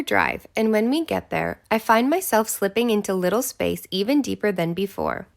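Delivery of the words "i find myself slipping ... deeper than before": ordinary delivery, nothing marked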